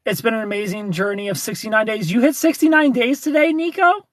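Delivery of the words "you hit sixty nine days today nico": excited. Real, not acted. Congratulating viewer on milestone.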